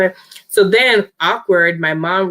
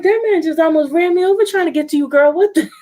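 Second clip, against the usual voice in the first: astonished mom; 10 semitones higher, faster than usual. Retold or imitated. Mom exclaiming in disbelief.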